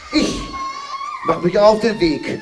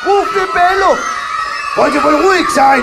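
Kasperle shouting; 11 semitones higher than usual. Acted. Kasperle rushing in against the robbers, shouting high.

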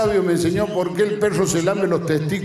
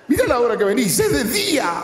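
scolding wife; 6 semitones higher than usual. Retold or imitated. Wife scolding husband voiced.

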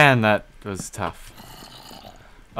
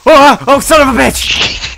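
high loud shriek; alarm shout; 13 dB louder than usual. Real, not acted. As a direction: shriek in panic. Creeper explodes.

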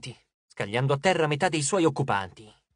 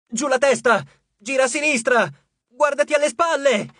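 high, loud barked commands; shouting orders; 7 semitones higher, 5 dB louder than usual. Acted. Piper riding Arion, shouting orders at the turtle. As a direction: yell orders at the monster. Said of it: "Piper urlava ordini".

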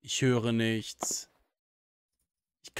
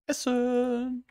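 silly voice; 11 semitones higher, 5 dB louder than usual. Pretend. Silly voice continues.

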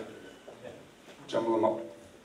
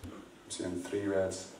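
I'm seeing three reds, slower and much softer; quiet repeat; 4 semitones lower, 11 dB quieter, slower than usual. Acted. Mentalist repeats reveal softly to volunteers, slow and hushed. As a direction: murmur reds like a secret. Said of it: "repeats reveal".

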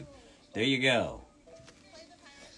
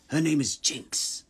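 loud, rasping villain outburst; Silco; 8 semitones higher, 11 dB louder than usual. Acted. Villain declaring her name, forceful raised voice. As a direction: hurl it out high and loud. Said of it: "A quote from Silco".

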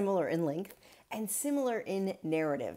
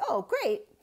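a bright, higher, louder "oh, great"; pleased exclamation; 6 semitones higher, 6 dB louder than usual. Retold or imitated. Jonathan imagining Saul pleased, light mock cheer. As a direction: toss it off like a relieved king.

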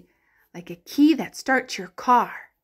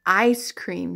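normal talking voice

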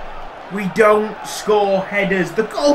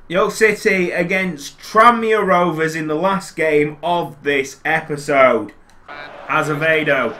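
normal talking voice

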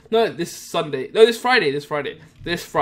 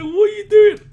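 higher, louder hammed-up cry of alarm; mock alarmed yell; 10 dB louder than usual. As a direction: yell it like the panicked friend. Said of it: "he's like".

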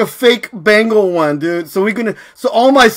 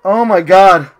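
ordinary talk, nothing marked

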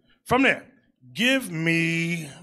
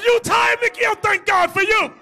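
much higher, louder teasing shout; mock relieved shout; 6 dB louder than usual. Preacher retelling teasing his tired security man, mock shout. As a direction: holler it with exaggerated relief.

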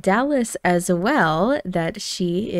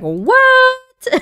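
high, loud shriek of disbelief; hammed-up astonished yelp; shocked; 6 dB louder than usual. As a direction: blurt it out high and loud, stunned. Mom shocked by prison news.

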